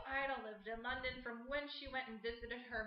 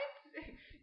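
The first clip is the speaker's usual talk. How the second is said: short laugh; 12 semitones higher, 7 dB quieter than usual. Real, not acted. Laughs at her own reaction.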